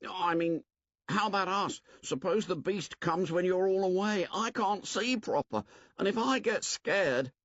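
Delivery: put-on high, worried boy's voice; anxious Piggy. Piggy asks Ralph what becomes of them, anxious. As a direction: plead not to be left behind.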